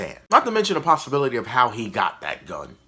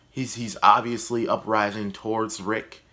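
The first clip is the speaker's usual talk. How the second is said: ordinary delivery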